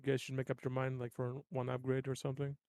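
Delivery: ordinary delivery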